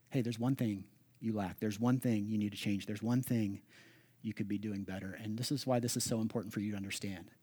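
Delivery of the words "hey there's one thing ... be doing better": soft, gentle voice of Jesus; gentle correction. Retold or imitated. Pastor voicing Jesus gently correcting a listener, soft and kind. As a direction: correct them gently and specifically, softer. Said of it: "Gently and specifically".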